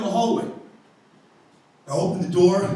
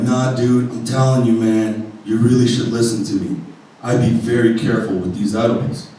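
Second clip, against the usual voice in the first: stoner voice; 8 semitones lower, 3 dB quieter than usual. Retold or imitated. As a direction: counter clerk drawling a warning. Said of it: "super stoner".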